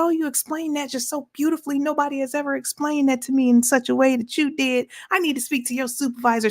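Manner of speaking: high, gushing impression of a grateful caller; overjoyed caller, high and fast at the end; high mock customer voice. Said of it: "She said".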